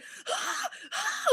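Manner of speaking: sharp high gasp of shock; a squeaky gasp hidden in the restroom; retold gasp. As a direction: gasp like you just heard huge news. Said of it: "like, freaking out".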